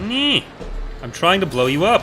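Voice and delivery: loud, high-pitched gamer yell; frustrated yell. Real, not acted. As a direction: shout it at the enemy ship. Frustrated yelling during a dogfight.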